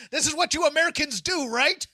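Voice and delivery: fast, loud bad Texas accent. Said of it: "doing the worst Texas accent".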